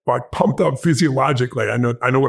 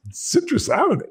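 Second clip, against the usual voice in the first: surprised question. Retold or imitated. Bank clerk puzzled by the address.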